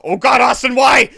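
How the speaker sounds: loud, high exasperated shout; outraged high yell; anguished yell. Real, not acted. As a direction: wail at a friend's betrayal. Betrayed by friend in game.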